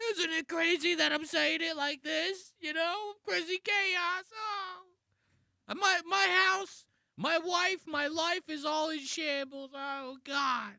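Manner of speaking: hammed-up panic, very high pitched; mock insecure guy. Reactor mocking a comedian's whole shtick, high fake panic. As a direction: squeal it like a frantic comic, mockingly. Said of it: "just being like".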